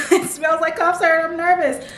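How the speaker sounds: high, giggling through the words; laughing. Real, not acted. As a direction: laugh it out, a bit nervous. Laughing at the smell.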